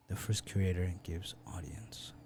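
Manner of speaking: whispered reread under the breath. Shown as a demonstration. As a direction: breathe the line out voiceless.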